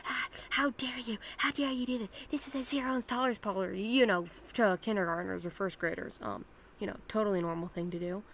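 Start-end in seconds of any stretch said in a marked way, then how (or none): 0.0-3.8 lightly hammed-up telling-off; mock scolding. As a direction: imitate an adult laying into kids. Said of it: "she starts laying into us".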